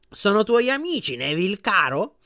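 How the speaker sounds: put-on a grand old witch asking graciously; gracious grandmother. A narrator's reading. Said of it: "gli chiese la nonna con grazia". (nonna aggraziata, voce più acuta e lenta)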